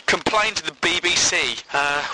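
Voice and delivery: normal talking voice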